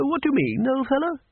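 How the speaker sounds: put-on high, puzzled elderly voice; a baffled old don; high old wizard. A narrator's reading.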